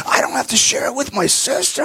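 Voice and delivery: put-on high, thrilled child's disbelief; a delighted six-year-old who can't believe it; amazed kid. Kid amazed at not sharing.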